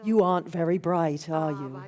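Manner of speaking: mock-pitying, pitched well down; condescending. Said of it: "people always look at you as if to say".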